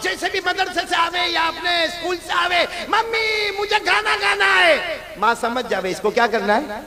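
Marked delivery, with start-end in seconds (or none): 2.9-5.0 put-on high, loud child's call to mummy; a child announcing from the door, loud and high. Child calling out on arrival.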